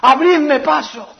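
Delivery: high pleading call to make way; urgent father's plea. Retold or imitated. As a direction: beg the crowd to let him through. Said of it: "decía". (el padre suplica que le abran paso)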